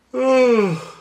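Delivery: big audible real yawn. Real, not acted. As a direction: let out a long yawn. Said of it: "Pardon me for yawning".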